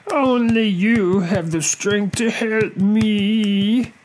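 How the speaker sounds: high, pleading crocodile voice; apologetic storybook crocodile begging for help; sincere crocodile apology. A narrator's reading. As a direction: draw out the first and last words in a remorseful wail. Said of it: "felt the sincerity of the crocodile".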